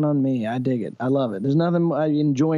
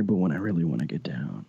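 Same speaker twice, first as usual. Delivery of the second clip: deep voiceover voice; 7 semitones lower, 6 dB quieter than usual. Shown as a demonstration. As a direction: drop into a low, soft voice. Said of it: "my normal speaking voice is kind of up here".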